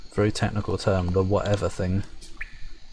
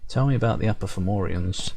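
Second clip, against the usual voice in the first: ordinary talk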